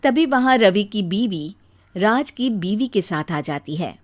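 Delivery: ordinary delivery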